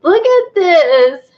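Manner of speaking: high, loud, stretched-out delight; thrilled. Real, not acted. Crafter showing her whole little scene, thrilled. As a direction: show it off slowly, squealing with pride.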